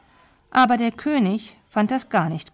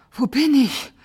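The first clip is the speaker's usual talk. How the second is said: higher, louder breathless question; panting; 6 semitones higher, 5 dB louder than usual. A narrator's reading. Knight in a pitch-dark cave, panting in alarm. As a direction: gasp first, then blurt it out. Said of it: "keuchte Ritter Tim".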